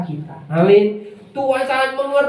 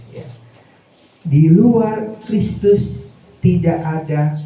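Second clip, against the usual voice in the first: ordinary talk, nothing marked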